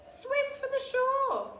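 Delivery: high, loud, slow call; creatures crying out. A narrator's reading. Creatures calling Alice to swim ashore, high and loud. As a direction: cry out to the swimmer, high and drawn out. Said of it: "they cried".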